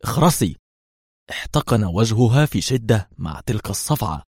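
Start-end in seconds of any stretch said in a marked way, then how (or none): 0.0-0.6 mild narrated shout; angry order, only lightly raised. A narrator's reading. Inspector slaps and silences his prisoner, a restrained angry shout. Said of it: "وهو يصرخ".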